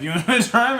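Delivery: high, loud retold challenge; mock exasperated question. Driver asking backseat-driving girlfriend, mock exasperation. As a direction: toss it at the passenger, pitched right up.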